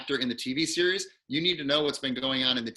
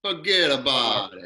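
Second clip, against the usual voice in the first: slow, louder New York "Forget about it", fuh-GET-uh-bout-it; 6 dB louder, slower than usual. Shown as a demonstration. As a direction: demo the New York catchphrase. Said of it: "How do you say it?".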